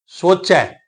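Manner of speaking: ਸੋਚੈ ending on an open "ai"; open final vowel. Shown as a demonstration. Teacher explains the double vowel mark, open-ended ਸੋਚੈ. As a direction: finish ਸੋਚੈ with a wide "ai", not "e". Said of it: "ਚੱਚੇ ਉੱਪਰ ਦੁਲਾਵਾਂ ਦਾ ਪਾਠ ਕਰਨਾ ਹੈ".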